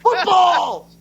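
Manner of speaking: loud exclaimed word; shouting. Real, not acted.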